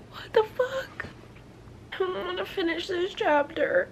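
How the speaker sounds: high, loud crying laugh that breaks into a sob; half laughing, half crying at the page; laughing through tears. Real, not acted. Reader overcome by the chapter, laugh-crying. Finishing emotional book chapter.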